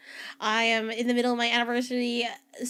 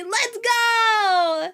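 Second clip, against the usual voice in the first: excited cheer; 7 semitones higher, 3 dB louder than usual. Real, not acted. Cheering for cards.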